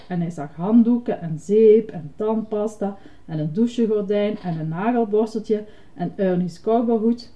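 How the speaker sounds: plain reading voice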